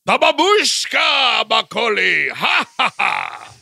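booming theatrical villain, drawn out; shouted high with a hammy spoken laugh; Gomez Addams. Acted. Host demonstrating the actor's odd accent, grandiose Gomez. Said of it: "it's Gomez Adams".

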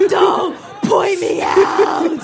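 slow, loud mock shout; embarrassed protest. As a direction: protest being pointed out, drawn out. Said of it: "Her face is like".

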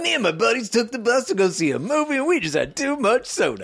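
high, laughing mock storyteller; high voice mocking the story.

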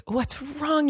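soft, high-pitched concerned parent; gentle parent. Retold or imitated. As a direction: ask it gently, high and soft, like speaking to an upset child. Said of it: "gentle parenting style".

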